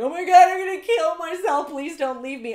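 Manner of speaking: beg, half-joking, as if weeping; mock weeping plea. Dumped partner on the ground, mock wailing. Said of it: "start weeping uncontrollably".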